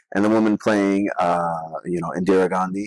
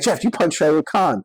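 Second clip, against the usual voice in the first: excited quote; 9 semitones higher than usual. Retold or imitated.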